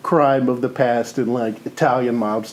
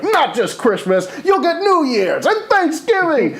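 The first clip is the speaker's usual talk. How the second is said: hammed-up rallying promise. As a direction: promise holidays like a rabble-rouser. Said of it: "he's just like".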